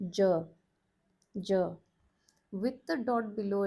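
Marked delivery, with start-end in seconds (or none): 0.1-1.7 "J" said crisply and clearly; J sound demo. Shown as a demonstration. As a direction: model "J" for the learner. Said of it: "the letter is pronounced as".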